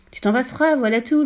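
plus aigu et plus fort, sans appel; réponds sèchement; épouse expéditive. A narrator's reading. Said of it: "lui dit sa femme". (la femme répond sèchement à son mari)

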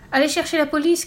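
high, loud call for help; acted urgent cry. A narrator's reading. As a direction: shout an order from the crowd. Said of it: "cria quelqu'un".

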